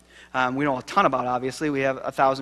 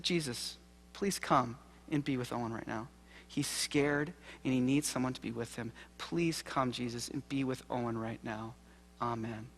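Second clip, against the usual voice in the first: hushed, tender prayer, much softer; soft bedside prayer; 3 semitones lower, 11 dB quieter than usual. Retold or imitated. Father praying over his scared son, soft and hushed. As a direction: pray gently over a frightened child.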